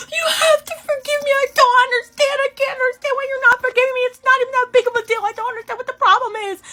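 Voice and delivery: high, wailing sobbed pleading; imitating a friend bawling on her knees; mock hysterical crying. Friend begging forgiveness in the hallway, wailing and sobbing. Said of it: "She starts hysterically crying".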